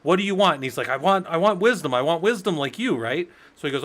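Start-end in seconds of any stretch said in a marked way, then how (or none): 0.0-0.6 raised, higher retold demand; insistent demand. Socrates demanding after dunking the man. 0.9-3.0 higher, louder retold plea; storyteller's mildly desperate, raised voice; mock sputtering plea. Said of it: "he comes up and he's sputtering".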